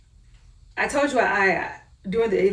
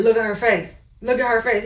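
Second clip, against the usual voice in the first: ordinary talk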